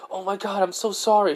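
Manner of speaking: put-on high, flustered young woman's apology; a clerk suddenly realizing her blunder. Edna apologising after realizing.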